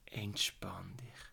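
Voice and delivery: slow soothing whisper. Acted. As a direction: breathe the words out gently. Relaxation exercise, calming the listener.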